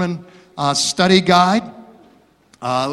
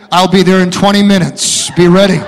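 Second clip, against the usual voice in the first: firm mother; 9 dB louder than usual. Acted. Mother hurries to rescue daughter.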